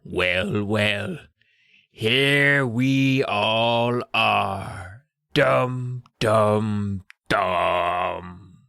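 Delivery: very drawn-out mock-villain gloating; sinister villain reveal. Old man greeting the trapped kids, mock sinister. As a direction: stretch it out like a villain springing the trap. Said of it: "sounds like the culmination of a sinister plan".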